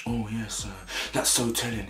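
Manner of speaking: half-managed impression of a smug remark; affected inflection. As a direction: attempt her affected inflection, understated. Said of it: "there was a certain inflection on her voice".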